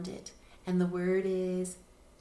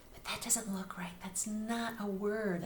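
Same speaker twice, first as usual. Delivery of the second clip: whispered aside; teacher whispering that it looks wrong; stage whisper. Shown as a demonstration. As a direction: whisper it like a secret. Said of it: "teacher's stage whisper".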